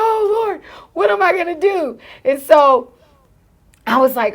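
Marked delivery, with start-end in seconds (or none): 0.1-2.0 high-pitched mock desperation; gasp, then plead in a high voice; acted-out fretful pleading; panicked prayer.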